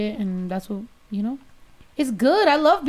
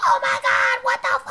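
shocked shout. Retold or imitated. Drunk friend shrieking on finding another Mexican. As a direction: shriek it like meeting a fellow Mexican abroad. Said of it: "So I was just like".

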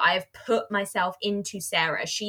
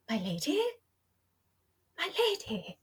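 softer; 7 semitones higher, 7 dB quieter than usual. Retold or imitated. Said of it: "those three times that he says".

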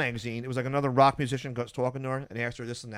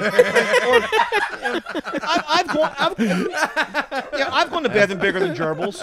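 high, loud, laughing through it; 13 semitones higher, 10 dB louder, slower than usual. Real, not acted. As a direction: crack up while telling it. Laughing punchline.

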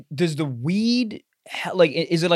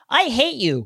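high-pitched mock teen outburst; hammed-up teenage tantrum; hostile teenager; 7 semitones higher than usual. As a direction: act out a teen lashing out at a parent. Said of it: "sixteen, and they go".

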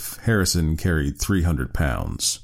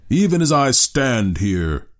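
slow, loud bellow, higher; 6 semitones higher, 4 dB louder, slower than usual. A narrator's reading. Harrison defying the handicappers, bellowing. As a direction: bellow it out defiantly. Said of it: "he bellowed".

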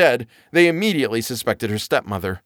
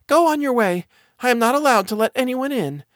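put-on high girlish voice; sweet young girl refusing; 13 semitones higher than usual.

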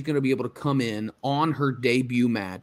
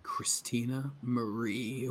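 hushed, breathy whisper of the name; 3 semitones lower, 11 dB quieter, slower than usual. Real, not acted. Co-host echoes name in awe.

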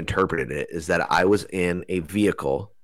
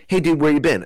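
cheerful greeting; 9 semitones higher, 4 dB louder than usual. Retold or imitated. Beings greet him happily.

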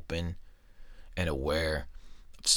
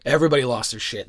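louder, faster, punch everybody; emphatic louder repeat; 6 semitones higher, 9 dB louder, faster than usual. Real, not acted. Storyteller hammering home the classroom panic, loud and fast. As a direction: blurt it out again with a burst of energy. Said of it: "And then everybody lost their shit".

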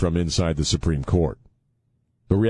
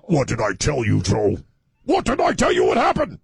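scolding wife voice. Retold or imitated. Mocking Mika scolding Joe.

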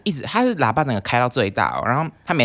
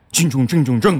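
mock drunk taunt; 3 semitones higher, 6 dB louder, slower than usual. Drunk strangers taunting the speaker with a slur, loud and mocking. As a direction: sneer it out like a tipsy thug. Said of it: "他们就说".

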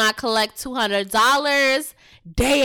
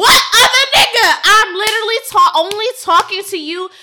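loud laughing retort; 8 semitones higher, 9 dB louder than usual. Retold or imitated. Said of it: "And I be like".

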